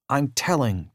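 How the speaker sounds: plain reading voice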